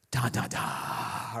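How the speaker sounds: slow, whispered da da da like suspense music; creeping film score; ominous soundtrack hum. Shown as a demonstration. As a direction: hum the movie's tension cue under your breath. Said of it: "where the soundtrack changes".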